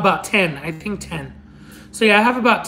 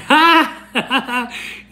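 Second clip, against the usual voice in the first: delighted laugh; 5 semitones higher than usual. Real, not acted. Laughing at viewer's story.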